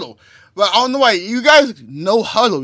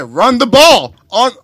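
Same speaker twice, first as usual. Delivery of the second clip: quoting Richard Sherman; 7 semitones higher, 10 dB louder, slower than usual. Retold or imitated. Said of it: "Richard Sherman said".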